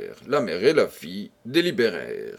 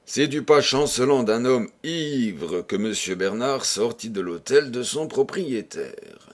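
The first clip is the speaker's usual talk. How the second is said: ordinary delivery, nothing marked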